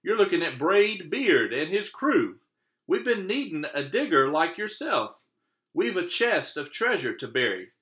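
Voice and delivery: high, loud pirate boast; pirate captain. Acted. Braid Beard introducing his crew to the boy, high and loud. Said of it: "he said".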